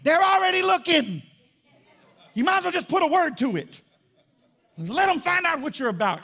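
high, loud pulpit shout; forceful preaching. Real, not acted. Preacher answering shy believer's excuse, shouted preaching.

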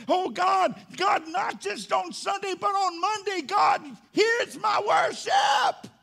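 high, full-voiced cry to God; shouted worship. Preacher declaring worship beyond Sunday, shouting high. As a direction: build to a long, belted final word.

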